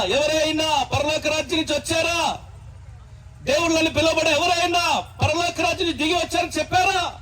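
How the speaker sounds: the pitch climbs up high, cried out at full force; fervent preaching shout. Real, not acted. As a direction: hammer each question home to the mourners. Funeral sermon, rising fervent challenge.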